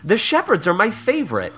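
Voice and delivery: a high, pouty church-lady voice; mock indignant columnist. Columnist grumbling to her family about the shepherds, a high pouty voice. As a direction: whine it like a put-out grandma.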